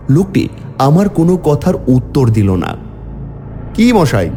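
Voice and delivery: plain reading voice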